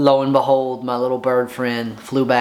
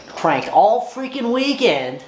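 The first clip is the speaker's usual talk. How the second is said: hyped up; 10 semitones higher, slower than usual. Real, not acted. Venting to viewers about cranking all weekend, hyped up. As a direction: pitch jumps much higher, worked up and exasperated. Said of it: "I'm getting all hyped up".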